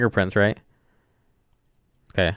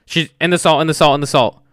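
excited; 6 semitones higher, 5 dB louder than usual. Real, not acted. Ghost seems to step in salt.

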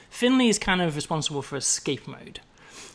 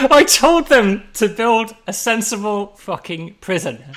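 laughing rant; 5 semitones higher, 7 dB louder, slower than usual. Real, not acted.

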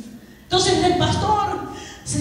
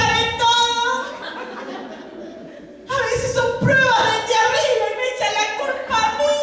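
high-pitched mock protest; aggrieved devil; 5 semitones higher than usual. Devil protests to the pastor about being blamed, high and mock-aggrieved. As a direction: plead innocence in a raised, hammed-up complaint. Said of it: "por qué estás llorando entonces".

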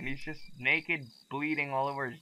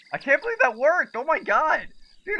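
high, loud, giddy disbelief; amazed excitement; 12 semitones higher, 6 dB louder than usual. Real, not acted. Gamer amazed the plan worked, excited. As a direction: exclaim in delighted disbelief, pitched high.

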